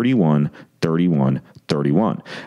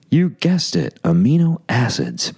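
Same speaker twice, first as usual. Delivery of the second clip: playful reveal; 7 semitones higher than usual. Acted.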